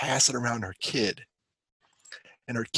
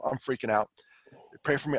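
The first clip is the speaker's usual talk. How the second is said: normal talking voice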